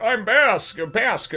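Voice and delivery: high, loud silly character voice; left head's voice. Retold or imitated. Left one of three dudes introducing himself, high and silly. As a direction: put on a goofy high-pitched introduction. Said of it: "the left one's like".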